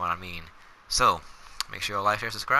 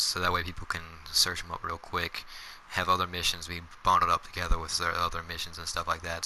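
normal talking voice

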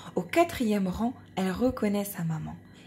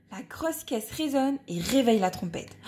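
ordinary delivery, nothing marked

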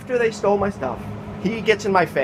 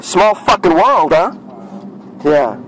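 gloating taunt; 2 semitones higher than usual. Real, not acted. Taunting the accused thief.

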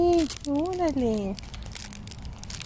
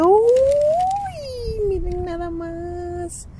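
high squeal, then slow and hushed; excited squeal; 11 semitones higher, much slower than usual. Real, not acted. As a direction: squeal with delight at the box. Opening the Halloween box.

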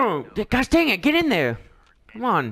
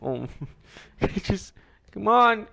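normal talking voice

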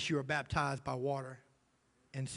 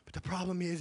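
normal talking voice

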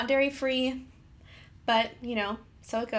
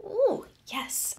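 excited; 10 semitones higher than usual. Real, not acted. Delight at peanut butter swirl.

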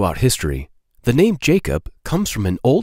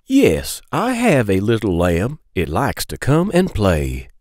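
singsong picture-book read-aloud; reading to children; slower than usual. Acted. Storyteller reading a lamb book to kids, singsong. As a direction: lilt the question refrain for little listeners.

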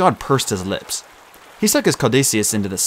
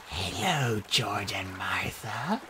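raspy whisper; 2 semitones lower, 12 dB quieter, much slower than usual. Acted. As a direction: hiss it like a snake. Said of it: "a raspy male voice said".

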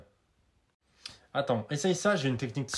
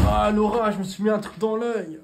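panicked cry; 7 semitones higher, 8 dB louder than usual. Acted. Something stuck in her eye.

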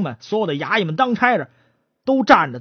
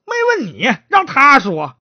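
acted brusque rebuke, pitched up; irritated magistrate; 7 semitones higher than usual. Magistrate hushing the suspect who was not asked, irritated. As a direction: cut the suspect off sharply.

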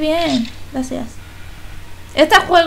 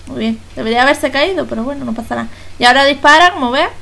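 normal talking voice

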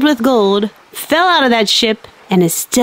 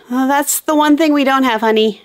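ordinary talk, nothing marked

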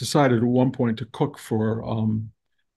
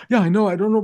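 higher, louder flustered self-imitation; retold flustered reply; 7 semitones higher, 5 dB louder, much faster than usual. Tense cook answering the teacher, retold flustered reply. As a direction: act out being tense under criticism, voice pitched up. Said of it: "been more tense in my life".

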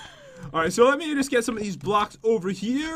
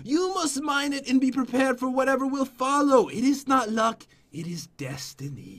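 solemn prophecy dying away; story reading; 4 semitones higher than usual. Acted. Reads the book aloud to friend.

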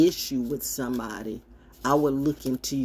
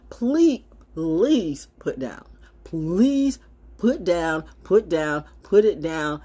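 slow, louder insistent please put down; drawn-out plea; 2 semitones higher, 4 dB louder, much slower than usual. Real, not acted. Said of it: "begging viewers to post links".

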